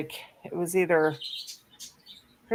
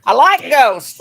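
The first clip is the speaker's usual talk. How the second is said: loud, high and eager; excited; 7 semitones higher, 12 dB louder, slower than usual. Real, not acted. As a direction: blurt it out gleefully. Excited about ghosts.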